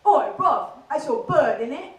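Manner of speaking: loud, cocky Essex lad; puffed-up Essex accent, calling out. Acted. Essex lad challenging his mate, in a loud Essex accent. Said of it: "average conversation goes a little bit like this".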